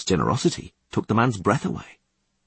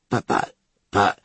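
put-on choking, stammering old man; having a heart attack. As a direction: sputter as if seized by pain. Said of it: "choked and clutched his chest".

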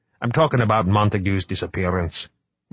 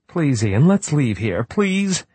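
higher, pleading and anxious; 8 semitones higher, 3 dB louder, slower than usual. Acted. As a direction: beg him to leave. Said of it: "Rickman pleaded".

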